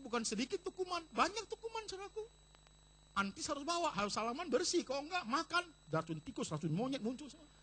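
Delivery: high, loud preaching at full force; fiery preaching. Acted. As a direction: preach it out to the whole church. Preacher rises again, full force.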